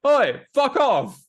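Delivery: loud, high, drawn-out mock shout; first go at a gruff footballer's heckle-back, stretched out; Roy Kent. Host imitating a footballer brushing off a fan, shouted impression. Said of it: "my Roy Kent impression".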